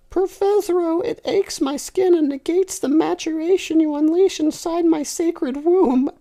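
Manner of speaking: put-on high, loud girlish lament; loud falsetto girl voice. Girl complaining to her professor, loud falsetto. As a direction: cry it out in falsetto.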